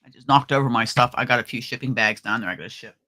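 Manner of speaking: ordinary delivery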